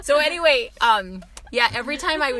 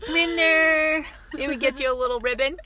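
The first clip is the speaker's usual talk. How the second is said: mock cheer; 7 semitones higher, 7 dB louder than usual. Mock award ceremony.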